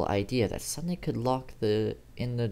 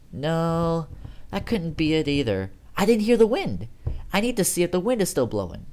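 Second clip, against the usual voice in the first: alarmed realization; 6 semitones higher, 4 dB louder than usual. A narrator's reading.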